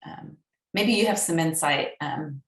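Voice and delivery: ordinary talk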